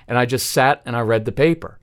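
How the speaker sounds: normal talking voice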